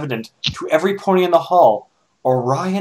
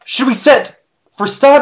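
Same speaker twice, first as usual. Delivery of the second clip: high, loud question; called question; 10 semitones higher, 5 dB louder than usual. Acted. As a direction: call out eagerly to the squad. Trooper asking companions.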